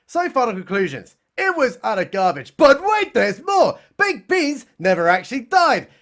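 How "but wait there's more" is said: "But wait" shouted much higher and louder; over-the-top "But wait, there's more" pitch; hammed-up infomercial. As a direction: blast it like a TV salesman. Infomercial catchphrase, third time.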